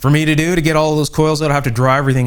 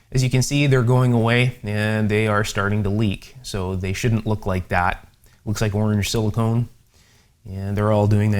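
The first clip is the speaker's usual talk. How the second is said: usual speaking voice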